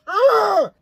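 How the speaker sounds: high, loud groan of revulsion; disgusted groan. Real, not acted. Reviewer remembering the chainsaw scene, loud disgusted groan. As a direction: groan as if recoiling from gore.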